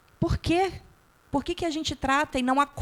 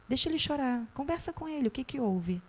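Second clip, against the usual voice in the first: quiet advice; 2 semitones higher, 7 dB quieter than usual. Retold or imitated. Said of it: "E eu falo".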